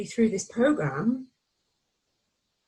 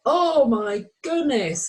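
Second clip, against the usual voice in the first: slow, louder and higher oh my goodness; heartfelt wonder; 5 semitones higher, 5 dB louder, much slower than usual. Retold or imitated. Coach showing a whole-body realization, slow and loud. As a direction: let it come up from the whole body. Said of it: "coming from the whole of your body".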